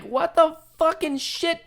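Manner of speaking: high, slow stunned cry; shocked disbelief. Retold or imitated. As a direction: drag it out in disbelief. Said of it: "And I'm just like".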